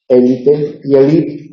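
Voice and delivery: élite then elite, slow and deliberate, first syllable stressed then last; careful slow demo of élite and elite; both stresses shown. Shown as a demonstration. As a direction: contrast élite and elite slowly. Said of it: "las dos formas son correctas".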